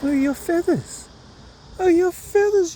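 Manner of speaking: high, slow sing-song pet talk; cooing at the bird. Real, not acted. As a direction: coo up high like praising a pet.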